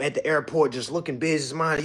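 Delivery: ordinary delivery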